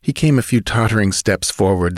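plain reading voice

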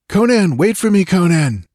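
high, loud distant call; calling from afar. A narrator's reading. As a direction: call out across the forest. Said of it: "a voice called faintly to him".

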